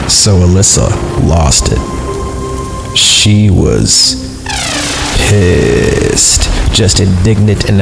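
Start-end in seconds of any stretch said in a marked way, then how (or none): 0.1-6.5 dramatic pause. A narrator's reading. Narrator reveals how angry Alyssa was, dramatically.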